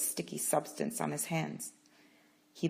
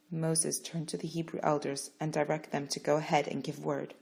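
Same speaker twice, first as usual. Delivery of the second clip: ordinary delivery, nothing marked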